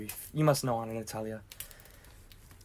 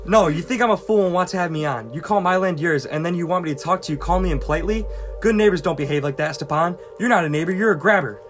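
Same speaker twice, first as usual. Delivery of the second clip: heated, louder protest; agitated anger; 5 dB louder than usual. Acted. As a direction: argue indignantly with a neighbor. Quarrel, told not to agitate himself.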